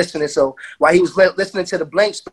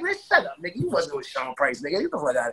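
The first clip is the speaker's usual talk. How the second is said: disbelieving dismissal; 8 dB quieter than usual. Retold or imitated. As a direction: scoff at a friend's boast. Mock disbelief in retold banter.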